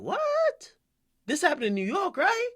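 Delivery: what shoots up high in disbelief; interviewer's surprise. Retold or imitated. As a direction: play the stunned interviewer. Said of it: "So the interviewer is saying".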